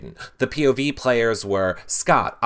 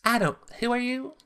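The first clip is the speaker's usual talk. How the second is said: imitating Shelby; 10 semitones higher, 5 dB quieter than usual. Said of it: "Shelby answered the door and went".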